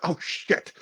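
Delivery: under-the-breath, whispered realization; hushed mock dismay. Killer sees the girl's horrified expression, hushed mock dismay. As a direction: imitate a sinking "uh-oh" moment, whispered.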